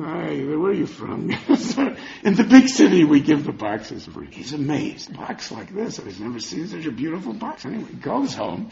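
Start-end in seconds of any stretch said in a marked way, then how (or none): 0.0-4.3 mocking laugh. Shopkeepers laugh at the customer.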